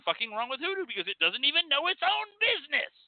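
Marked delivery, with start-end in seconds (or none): 1.4-2.9 high-pitched mocking. Real, not acted. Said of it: "repeated in high voice".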